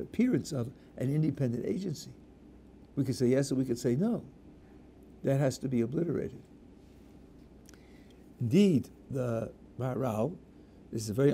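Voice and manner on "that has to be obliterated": ordinary talk, nothing marked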